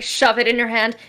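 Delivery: ordinary talk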